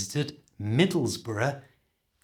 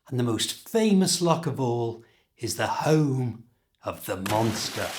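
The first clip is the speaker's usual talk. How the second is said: ordinary talk, nothing marked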